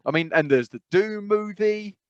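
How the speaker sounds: ordinary talk, nothing marked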